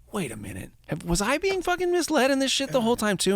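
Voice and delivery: a hammed-up what-the-hell moment; mock disbelief. Retold Bencher realizing.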